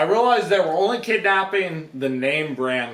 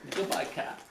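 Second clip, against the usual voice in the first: breathy whisper; barely voiced farewell; whispered goodbye; 12 dB quieter than usual. Bidding cat farewell.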